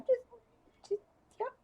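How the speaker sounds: very high, fast squeak; squeaky embarrassed voice. Real, not acted. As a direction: squeak it out sheepishly.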